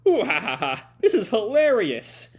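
high, loud mock cackle into words; hammed-up villain's laugh, gloating; villainous laughing. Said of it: "Nase just laughs".

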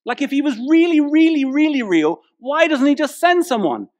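mock the insistent sceptic; skeptical questioner. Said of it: "People say to me".

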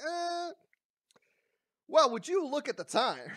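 drawn "uh", then a loud, high excuse; mock evasive dodging. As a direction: imitate someone dodging the question, high and loud. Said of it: "You're just being like".

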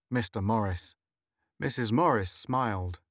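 plain reading voice

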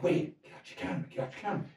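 urgent hushed whisper. Retold or imitated. To his friend beside Oprah, urgent whisper. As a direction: hiss the order so Oprah won't hear. Urging Wade quietly near Oprah.

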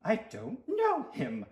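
disowning him through a fixed smile; through gritted smile. A narrator's reading. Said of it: "said through a gritted smile".

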